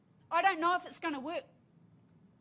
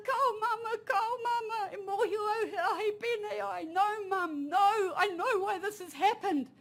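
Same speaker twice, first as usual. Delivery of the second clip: put-on higher, pleading child voice on the edge of tears; frightened young son; 6 semitones higher than usual. Young son begging his mother during the earthquake, tearful and high. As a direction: play a terrified six-year-old begging his mum. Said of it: "my boy goes".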